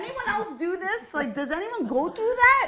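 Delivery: high, loud, incredulous venting; exasperated rant. Real, not acted. Woman venting to friend about constant fights, exasperated.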